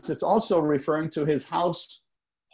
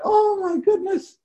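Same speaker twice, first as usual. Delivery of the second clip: imitating dad; 5 dB louder than usual. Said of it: "I said that just like my dad said it".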